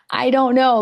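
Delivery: half-laughing exclamation of "I don't know"; loud laughing outburst. Real, not acted. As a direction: blurt it out, giggling and exasperated. Laughing, flustered at the camera.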